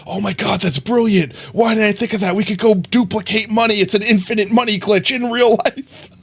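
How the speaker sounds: lightly mocking an easily fooled viewer; mock gullible viewer. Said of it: "people that'll watch us be like".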